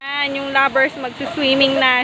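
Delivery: ordinary delivery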